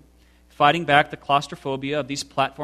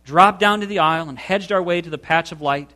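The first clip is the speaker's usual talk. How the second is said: usual speaking voice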